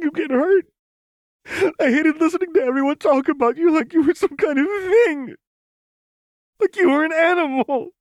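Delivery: high, loud, tearful wail; sobbing outburst. A narrator's reading. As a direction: sob it out, heartbroken and furious. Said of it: "sobbed against her shoulder".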